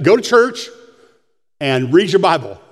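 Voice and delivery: ordinary talk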